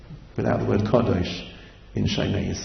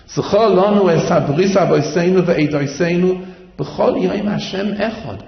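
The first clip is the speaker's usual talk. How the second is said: higher, louder chanted plea; fervent pleading prayer; 6 semitones higher, 5 dB louder than usual. Acted. Preacher voicing the congregation's plea to God, fervent.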